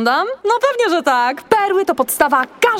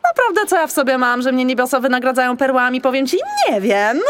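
usual speaking voice

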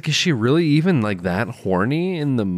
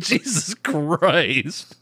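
exasperated; 6 semitones higher, much slower than usual. Retold or imitated. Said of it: "And you're like".

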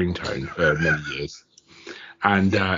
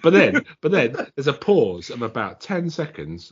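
usual speaking voice

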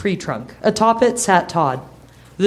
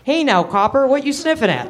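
put-on old dog voice; 8 semitones higher than usual.

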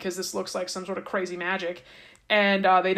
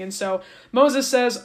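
usual speaking voice

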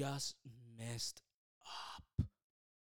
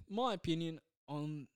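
ordinary delivery

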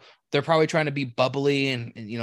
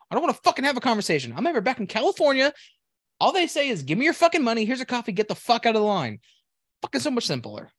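high, louder, fast exasperated rant; fed up with chatty baristas, ranting higher and louder; worked-up rant; 10 semitones higher, 4 dB louder than usual. Real, not acted. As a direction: vent it, fed up.

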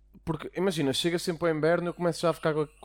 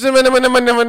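loud protest; 10 semitones higher, 16 dB louder, much slower than usual. Real, not acted. Mock protest before joking.

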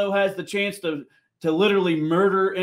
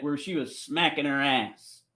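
usual speaking voice

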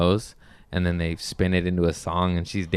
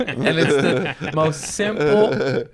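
laughing; 6 semitones higher, 3 dB louder, slower than usual. Real, not acted. Laughing while retelling.

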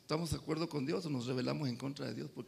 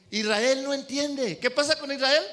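loud, high, slow proclamation; emphatic preaching; 7 semitones higher, 7 dB louder, slower than usual. Acted. Preacher proclaiming to the congregation, loud and slow. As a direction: drive the line home to the church.

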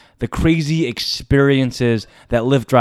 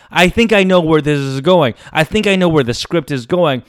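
normal talking voice

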